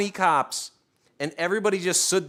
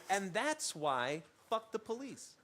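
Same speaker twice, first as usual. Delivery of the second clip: quiet callback; 14 dB quieter than usual. Real, not acted. Callback mutter.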